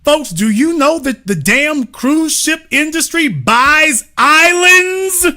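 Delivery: high, drawn-out disbelief; incredulous reveal. Real, not acted. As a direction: build to an astonished question.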